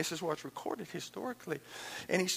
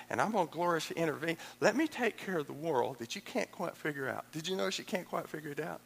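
ordinary talk, nothing marked

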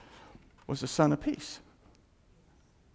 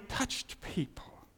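slow, hushed whisper; 12 dB quieter, much slower than usual. Acted. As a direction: breathe it out as a secret. Quoting God's hushed message.

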